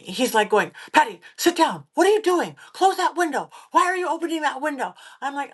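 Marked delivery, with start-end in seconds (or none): 0.9-4.9 high, raised-voice scolding in imitation; yelling. Zach yelling at her by the open window, imitated high and loud. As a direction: mimic an alarmed colleague's sharp orders. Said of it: "Zach starts yelling at me".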